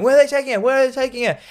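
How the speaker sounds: very high-pitched mock frantic cries; Hud. Hud as his friend is dragged off, high mock alarm. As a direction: repeat it in pretend distress. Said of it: "We hear Hud going".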